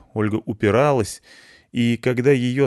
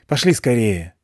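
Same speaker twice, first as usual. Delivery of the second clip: urgent call; 2 semitones higher, 4 dB louder than usual. Acted. Urging to hurry.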